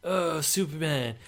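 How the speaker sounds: high, drawn-out mocking gush; Zack Snyder. Podcaster parodying the director's take on dark heroes, mock awe. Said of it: "Zack Snyder's not gonna be like".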